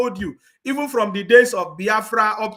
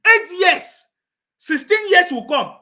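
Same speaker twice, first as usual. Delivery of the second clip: loud, high, slowed outcry; shouting the years, high and slow; emphatic shout; 8 semitones higher, 7 dB louder, slower than usual. Real, not acted. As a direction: bellow the years out at them. Ranting, emphatic.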